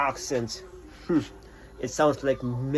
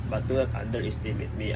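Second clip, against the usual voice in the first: low, soft, fast mock menace; 5 semitones lower, 5 dB quieter, faster than usual. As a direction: drop down low and quiet, a hammed-up warning. Final warning, lower and softer.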